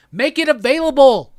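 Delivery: ranting at Taco Bell, a loud angry shout. Real, not acted. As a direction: bark the order at the company.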